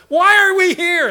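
high, loud whiny wail; loud, high mock whine; whining complaint. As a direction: wail it like a griping crowd. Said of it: "whining and complaining".

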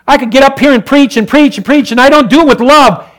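loud, high preacher's cry; loud gospel preaching; fiery preaching. Acted. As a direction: proclaim the commands to the congregation. Preaching climax.

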